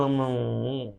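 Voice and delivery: low wordless grumbling hum; muttered "hmm" under the breath; demonstrated mumbling. Shown as a demonstration. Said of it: "बड़बड़ाना".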